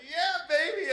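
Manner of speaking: smug. Retold or imitated. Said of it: "you're like".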